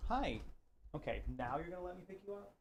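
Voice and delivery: high, soft cooing pet voice. Real, not acted. Baby talk to the cat.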